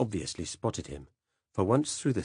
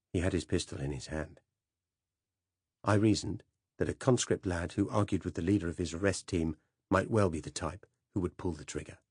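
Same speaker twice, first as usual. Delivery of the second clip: usual speaking voice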